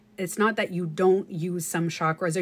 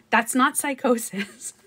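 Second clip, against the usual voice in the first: normal talking voice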